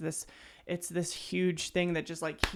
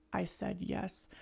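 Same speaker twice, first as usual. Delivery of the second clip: soft quote; 8 dB quieter than usual. Retold or imitated. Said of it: "I loved the way he sang".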